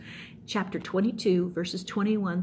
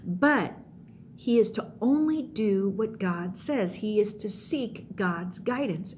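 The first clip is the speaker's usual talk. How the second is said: ordinary delivery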